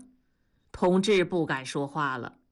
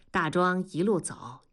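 ordinary delivery

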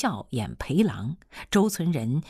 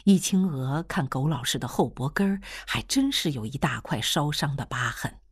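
ordinary delivery, nothing marked

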